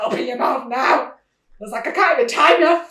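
loud, high mock scolding; mother demanding her son open his mouth, loud mock scolding; retold scolding. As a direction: boss him around like a furious mum. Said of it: "I was so mad".

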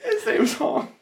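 high squealing laugh through words; cracking up while talking. Real, not acted. Laughing at the name.

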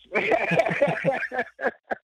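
real laughter; laughing. Real, not acted. Laughs at no regrets.

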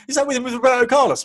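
put-on thrilled little boy spotting another star; excited child. Son excited over photo.

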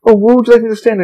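high, eager-to-please imitation of a guest; respectful guest, imitated. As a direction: ask like an obliging visitor. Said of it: "people are being very respectful".

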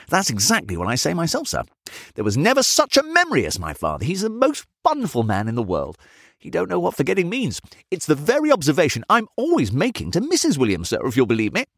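high, effusive character voice; agreeable servant. A narrator's reading. Son praising his father's memory to his master, effusively. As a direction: agree effusively, pitched well up. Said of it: "delivered this as if there were no iota of contradiction".

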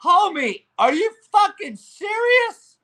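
laugh, then a high disbelieving shout; incredulous laugh. Real, not acted. Mocking fans who pay to watch stars sit out, with an incredulous laughing shout.

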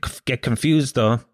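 ordinary talk

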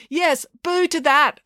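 slow, high public endorsement; drawn-out high rallying reply; rousing agreement. A narrator's reading. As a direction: agree emphatically over a crowd. Maya answers heckler from stage.

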